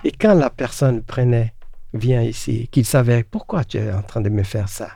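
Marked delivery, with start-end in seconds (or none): 3.3-4.9 softly voiced bewildered lamb; quiet plea. Lamb to the one about to kill it, quiet plea. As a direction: lower the voice into a hurt question.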